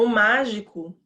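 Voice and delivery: "o mágico" with MÁ loud and held; imitating teachers' stress. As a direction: exaggerate the MÁ in "mágico" like a classroom teacher. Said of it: "até as professoras fazem assim". (imitando professora, "mágico" com MÁ bem forte)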